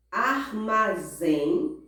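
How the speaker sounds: "armazém" slowly split ar-ma-ZÉM; stress on last syllable. Shown as a demonstration. Teacher gives oxytone example, slowly stressing last syllable. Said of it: "elas têm a última sílaba mais forte".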